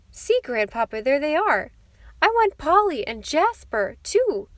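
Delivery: put-on high, loud, delighted child; crow of delight. A narrator's reading. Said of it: "a little crow of delight".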